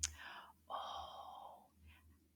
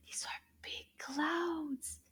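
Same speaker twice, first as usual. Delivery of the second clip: whispered aside; 3 semitones higher, 9 dB quieter, slower than usual. Acted. Puppet scene aside.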